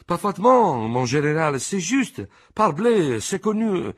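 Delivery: a rowdy chorus of agreement; old soldiers eagerly agreeing with their general; assorted chorus. Acted. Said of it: "un coro assortito".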